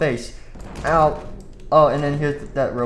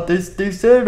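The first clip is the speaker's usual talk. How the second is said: normal talking voice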